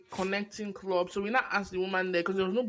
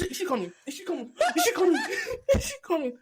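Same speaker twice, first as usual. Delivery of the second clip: high, laughing repeated question; giggling while nervously asking if she's coming back; laughing nervous check; 9 semitones higher, much faster than usual. Retold or imitated. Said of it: "We now, Florence".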